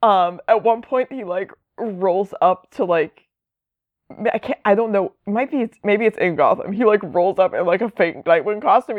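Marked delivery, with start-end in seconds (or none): none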